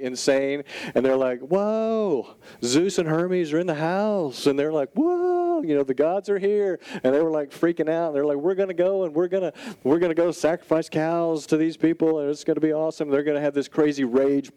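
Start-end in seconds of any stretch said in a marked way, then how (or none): 1.4-4.5 high, slow whoa of a crowd in awe; amazed crowd. Retold or imitated. Crowd voiced, amazed at healing. 4.9-6.8 very high whoa, then excited crowd; hyped-up crowd sure the gods are here; amazed crowd. Retold or imitated. Crowd voiced, freaking out. 8.4-13.1 excited crowd. Retold or imitated. Crowd voiced planning sacrifice party.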